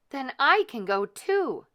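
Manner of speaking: eager to be included; hopeful. A narrator's reading. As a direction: Cinderella hoping to go. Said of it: "said Cinderella".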